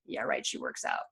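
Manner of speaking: eye-rolling whispered scorn; mocking whisper. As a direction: hiss it like a snide aside to a friend. People mocking her behind her back.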